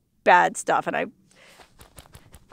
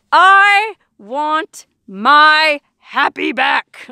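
very slow, louder hammed-up whine; mock whine; 4 semitones higher, 6 dB louder, much slower than usual. Wants her happiness back, a slow loud mock whine. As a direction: drag it out like a pouting kid. Mock-whiny demand, laughs after.

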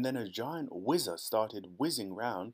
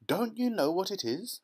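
proud Wonka; 9 semitones higher, 4 dB louder than usual. A narrator's reading. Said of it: "gazing proudly".

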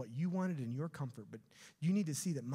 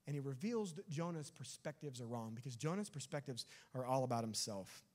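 ordinary talk, nothing marked

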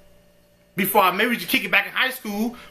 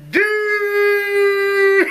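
anguished shout; 13 semitones higher, 5 dB louder than usual. Real, not acted. Reacting to the boyfriend's line.

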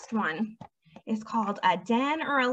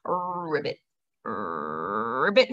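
much lower, croaky frog ribbit; frog croak; 13 semitones lower, much slower than usual. Shown as a demonstration. Teacher giving the frog's sound as a riddle for the children, croaking. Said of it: "they go ribbit".

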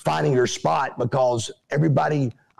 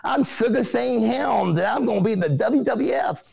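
mocking lisp; 6 semitones higher than usual. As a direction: lisp every s like a taunt. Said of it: "It's gonna sound something like this".

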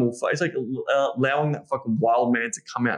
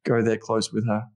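ordinary talk, nothing marked

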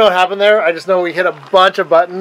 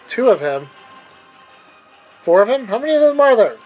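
surprised; 7 semitones higher, 6 dB louder than usual. Real, not acted. Clones multiplying on screen.

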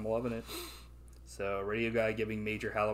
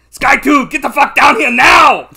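very loud, high action-hero roar; hammed-up screaming movie-star impression; Arnold Schwarzenegger; 23 dB louder than usual. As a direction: bellow it into the radio at full force. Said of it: "Yeah, Arnold".

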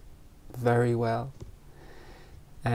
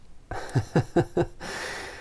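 real light chuckle, mostly breath; soft, rippling giggle; amused chuckle; 5 semitones higher, 4 dB quieter than usual. Real, not acted. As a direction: let out a quiet run of little laughs. Amused at own heat remark.

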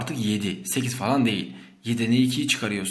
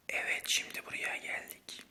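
fısıltı; 10 dB quieter than usual. Real, not acted.

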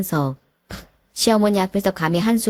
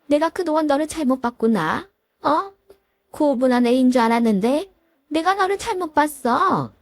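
a lightly voiced stern mother-in-law, a touch higher; 시어머니 목소리; 6 semitones higher than usual. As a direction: scold the daughter-in-law with cold authority. (살짝 높인 깐깐한 시어머니 목소리)

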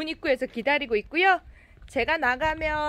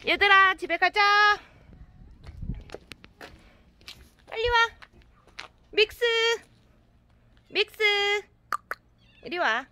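calling the cats; 6 semitones higher than usual. Real, not acted.

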